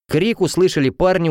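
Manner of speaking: ordinary delivery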